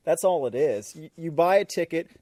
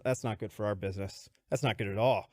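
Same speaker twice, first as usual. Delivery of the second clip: grave disapproving boss; 6 semitones lower, 4 dB quieter than usual. Retold or imitated. Boss judging the weaknesses.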